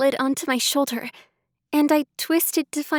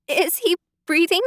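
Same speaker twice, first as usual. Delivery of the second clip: choked; 5 semitones higher than usual. Acted. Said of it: "I choked out".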